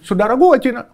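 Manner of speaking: ordinary delivery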